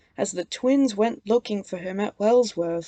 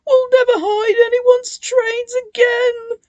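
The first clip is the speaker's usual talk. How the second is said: high, loud tearful promise; crying; 8 dB louder than usual. A narrator's reading. Ben begging the ghost, crying. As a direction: sob out a promise. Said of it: "cried Ben".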